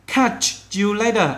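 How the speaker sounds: loud, high, slow model line; friendly goodbye demo. Shown as a demonstration. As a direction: say it casually. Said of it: "rồi". (chào tạm biệt mẫu thân mật)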